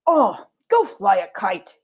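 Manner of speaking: slow, fed-up dismissal; drawn-out irritated sigh of a line; exasperated brush-off. Acted. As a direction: send her off in a huff. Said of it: "threw down his napkin".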